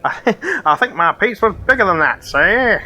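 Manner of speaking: high, silly skeleton voice; goofy skeleton fussing over its bones. Acted.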